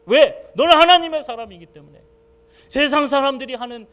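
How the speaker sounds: loud, high fervent preaching; preaching at full force. Acted. Pastor proclaiming to the congregation, loud. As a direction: proclaim it with fire.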